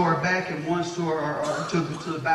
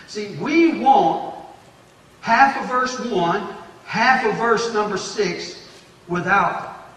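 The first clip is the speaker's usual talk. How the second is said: slow, higher and louder; emphatic preaching; 4 semitones higher, 6 dB louder, slower than usual. Real, not acted. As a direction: hammer each phrase out. Driving the point home.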